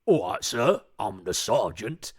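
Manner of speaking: loud, drawn-out sergeant caricature; clichéd army sergeant. Acted. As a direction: bellow it like a stock military sergeant. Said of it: "don't play them as your cliched".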